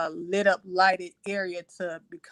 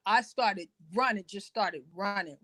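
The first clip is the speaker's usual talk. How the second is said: ordinary delivery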